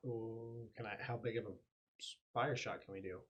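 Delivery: normal talking voice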